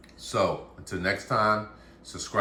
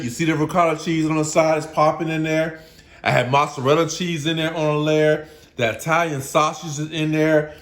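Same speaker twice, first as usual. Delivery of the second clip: louder and higher, gushing with pride; excited; 7 semitones higher, 6 dB louder than usual. Real, not acted. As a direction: show off every layer, thrilled. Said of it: "I get excited about this".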